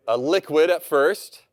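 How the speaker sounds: normal talking voice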